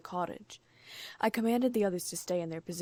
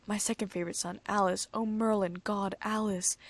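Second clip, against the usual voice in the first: ordinary delivery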